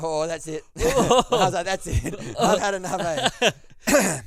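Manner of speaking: mock fed up, cracking up; laughing exasperation. Retelling his reply to the knife girl, loud laughing exasperation.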